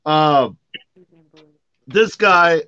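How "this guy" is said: usual speaking voice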